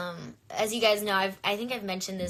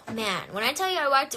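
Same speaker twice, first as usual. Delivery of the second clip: normal talking voice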